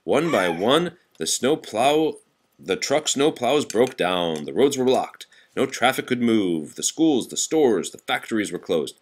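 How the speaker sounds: usual speaking voice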